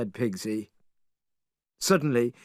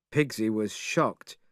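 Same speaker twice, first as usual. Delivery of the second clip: usual speaking voice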